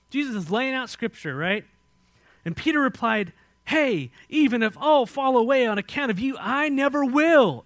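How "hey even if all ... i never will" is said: loud, high declaration of loyalty; bold vow. Acted. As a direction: proclaim Peter's vow, drawing out the ending. Peter's bold vow to Jesus, acted.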